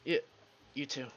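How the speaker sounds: usual speaking voice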